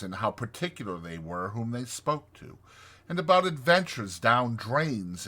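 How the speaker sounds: plain reading voice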